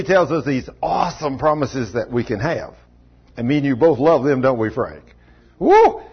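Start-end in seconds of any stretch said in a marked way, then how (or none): 5.6-6.0 excited whoop. Real, not acted. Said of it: "enthusiastic shout".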